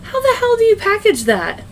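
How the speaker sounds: high, disbelieving retold question; incredulous. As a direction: ask it in total disbelief. Retold own reaction, incredulous.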